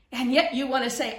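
ordinary talk

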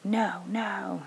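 put-on voiceless "No, no" of a hurt kid; imitating a sulky whisper; whispered refusal. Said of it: "And he's like".

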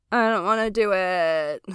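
whine. Real, not acted. Podcaster dreading sharing this one with listeners, whining. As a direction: whine it, dragging the words out. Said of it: "Wow, that was a whine".

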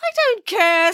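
high, emphatic scolding; indignant outburst. Acted. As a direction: shut the robber down shrilly. Nanny quarrels with the robber.

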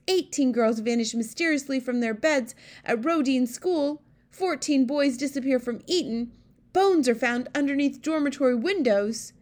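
loud, high reading of headlines; shocked queen. A narrator's reading. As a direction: declaim each headline in alarm. Queen reads headlines aloud, shocked.

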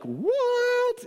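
can't believe what she just heard; stunned disbelief. Retold or imitated. Husband hears his wife got in, stunned. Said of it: "And I was like".

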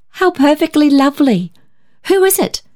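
high, delighted cry; cried out. A narrator's reading. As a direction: gush with delight for a friend. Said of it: "cried Muriel".

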